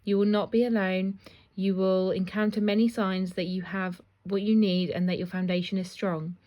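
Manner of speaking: ordinary talk, nothing marked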